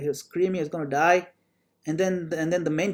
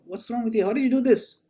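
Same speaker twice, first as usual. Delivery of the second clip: higher-pitched, mildly upset retelling; lightly acted indignant king's questions; 6 semitones higher than usual. King confronting his minister after losing his thumb, mildly upset. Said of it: "the king was so upset".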